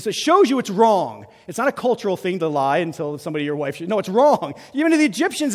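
ordinary delivery

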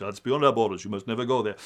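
Mufasa voice. Retold or imitated. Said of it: "and Mufasa says".

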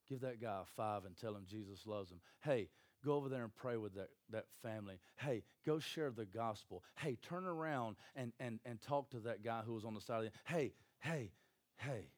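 soft, low nudge with short heys; quiet prompting. Acted. The Spirit nudging a believer to act, quiet prompting. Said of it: "the Spirit's like".

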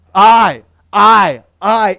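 "I" punched hard and loud, repeated; stress on I. Shown as a demonstration. Preacher stressing God's repeated "I" to the congregation, punched loud. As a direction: hit each "I" like a hammer. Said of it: "emphasis on the word".

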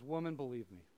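soft, low "Woman, believe me"; gentle appeal. Retold or imitated. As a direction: say "Woman" tenderly, not harshly. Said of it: "he's not like".

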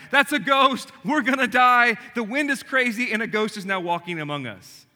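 hammed-up ghost panic; mock panic. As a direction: shriek it like terrified fishermen. Disciples freak out like.

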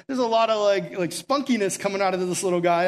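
ordinary talk, nothing marked

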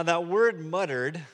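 normal talking voice

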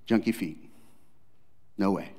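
normal talking voice

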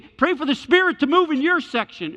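high, loud revival-preacher cry to the crowd; faith healer call. Retold or imitated. Faith healer urging the arena crowd to pray, loud and high.

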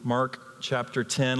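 ordinary talk

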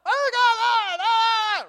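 high, loud drawn-out holler; hammed-up yell of a man in pursuit; mock yelling. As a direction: wail it out like a comic chase. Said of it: "And he's yelling".

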